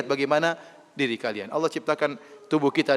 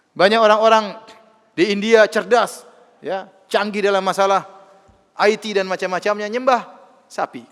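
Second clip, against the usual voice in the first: rising, louder preaching voice; preaching at full force; 5 semitones higher, 7 dB louder than usual. Acted. Preacher stirring up the congregation, preaching at full force. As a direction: hammer the point home from the pulpit.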